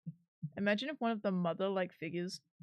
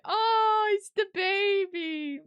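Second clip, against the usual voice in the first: put-on high, loud cooing baby talk; 11 semitones higher, 9 dB louder, much slower than usual. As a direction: squeal at a cute baby. Everyone cooing at Maroon.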